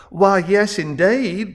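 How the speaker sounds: high Southern belle lilt; Deep South belle. A narrator's reading. Balloony answering through Kelbaugh, high Deep South belle drawl. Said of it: "sounded like a U S Deep South belle".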